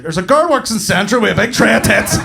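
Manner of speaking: loud, high, bursting confession; eager blurting. Retold or imitated. Blurts out his own hall pass.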